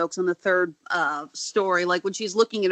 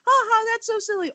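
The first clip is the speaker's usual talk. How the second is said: high, put-on ha-ha; mock amusement; 11 semitones higher than usual. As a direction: chirp it high like an amused reader. Acting out a reader's amused reaction.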